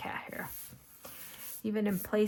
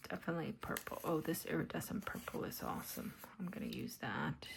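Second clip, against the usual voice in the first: whispering; 2 semitones lower, 6 dB quieter than usual. Acted. Whispered craft chat.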